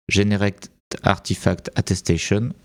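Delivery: English read with a French accent; "attestation" as a French-accented English "atesteshun". Real, not acted. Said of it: "excusez mon accent anglais".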